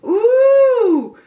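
high loud delighted ooh; sudden thrilled whoop; excited squeal. Real, not acted. Excited reader reacts to card.

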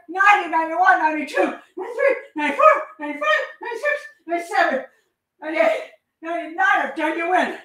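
high, fast, loud final push; acted frenzy at full tilt; climactic shouted count. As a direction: drive the count up to a frenzied finish. Climax of acted hundred-stab count.

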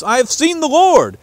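high, loud and elated; joyful announcement. Acted. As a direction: burst out with the news. Mary announces to the disciples.

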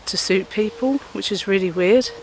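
usual speaking voice

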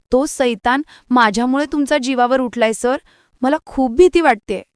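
high-pitched distressed plea, lightly acted; tearful, frightened. A narrator's reading. Ishwari telling sir how scared she is, mildly tearful. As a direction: plead as if close to tears. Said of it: "ईश्वरी रडतच बोलते".

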